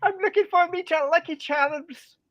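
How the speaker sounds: high, loud leprechaun voice. Pretend. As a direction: go full cartoon leprechaun. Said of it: "like a broken leprechaun".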